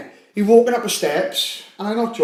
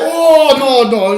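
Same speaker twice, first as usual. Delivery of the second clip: high, louder mock alarm; alarmed doctor; 6 semitones higher, 5 dB louder, much slower than usual. Chinese doctor hearing about the vodka, high mock-alarmed protest. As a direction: play a doctor dismayed by the patient. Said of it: "The man's like".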